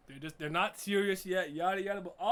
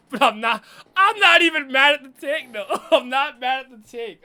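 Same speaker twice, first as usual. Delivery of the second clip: much louder, higher, insisting; emphatic loud insisting; 8 semitones higher, 16 dB louder than usual. Real, not acted. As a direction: shout it, defending the take. Insisting on a hot take.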